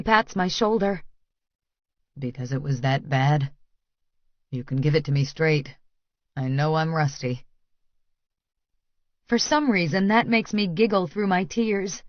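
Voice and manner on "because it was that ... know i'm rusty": low, softened man's voice, gently joking; self-deprecating male lover. A narrator's reading. Lover asking if it was that bad, low and wry.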